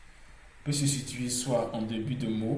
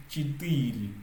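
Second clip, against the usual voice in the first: Chitiri with a long, emphasized middle "ti"; stressed second syllable. Shown as a demonstration. Teacher repeating the word for learners, Chitiri stressed on "ti". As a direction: dwell on the second syllable of Chitiri, then fall. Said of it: "Vous voyez comment j'insiste là-dessus".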